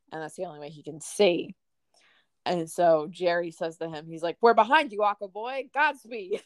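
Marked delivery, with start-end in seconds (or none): 4.4-6.4 mock rally cry. Jerry mocking George.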